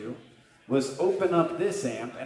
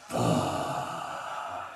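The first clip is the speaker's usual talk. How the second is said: a hushed, much lower whispered curse; whispered dread. Acted. Comedian seeing the security tape, whispered curse.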